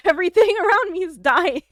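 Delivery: high, loud laughing panic; anxious laughing. Real, not acted. Fretting over her pet to Okami, laughing. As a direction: laugh out the worry. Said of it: "I'm anxious now".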